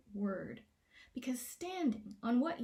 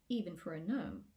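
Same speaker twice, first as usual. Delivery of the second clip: ordinary delivery, nothing marked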